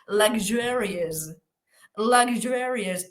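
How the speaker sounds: Luxurious slow, lug-ZHOO-ree-us; slow prized demo of Luxurious; model pronunciation. Shown as a demonstration. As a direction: stretch Luxurious syllable by syllable. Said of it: "la joya de la corona".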